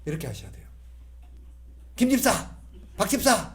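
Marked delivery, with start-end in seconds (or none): 2.0-3.5 the wrong way to address church deacons, curt; curt call. Shown as a demonstration. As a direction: drop the title, brusque. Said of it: "이렇게 부르지 마시고".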